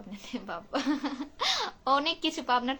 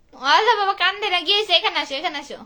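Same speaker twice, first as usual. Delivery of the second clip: loud soothing call; 6 semitones higher, 10 dB louder, faster than usual. Real, not acted. Mother calling her crying daughter over, loud and coaxing.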